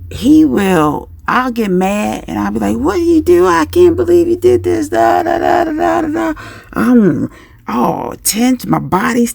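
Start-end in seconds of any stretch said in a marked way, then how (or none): 2.8-6.3 high-pitched scolding tirade; mock angry yelling. Parent getting mad at the child, acted-out yelling. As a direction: rant high, running off into da-da-da. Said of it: "I'm yelling".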